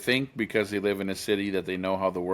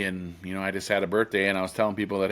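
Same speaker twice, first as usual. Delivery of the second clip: ordinary talk, nothing marked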